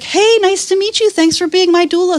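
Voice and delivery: high-pitched polite hello; put-on high, friendly greeting; chirpy client greeting. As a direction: imitate a client greeting her doula. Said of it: "you go in and they're like".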